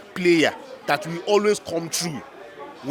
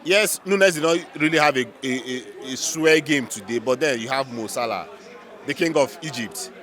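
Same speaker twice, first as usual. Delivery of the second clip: ordinary talk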